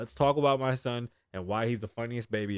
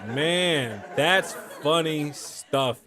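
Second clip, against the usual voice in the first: slow words over a laugh; much slower than usual. Real, not acted. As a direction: wind down the laughter. Recovering from laughter.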